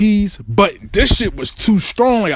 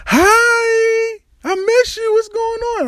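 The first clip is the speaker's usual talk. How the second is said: put-on high, sweet, sing-song girlfriend greeting; girlfriend's sweet voice; slower than usual. Girlfriend greeting him from bed, high sugary voice. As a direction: play her all sugary and happy to see him. Voicing his happy girlfriend.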